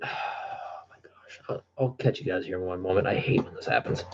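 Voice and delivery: very soft, low, half-whispered grumble; frustrated muttered aside. Real, not acted. Streamer excusing himself to viewers before stepping away, frustrated mutter.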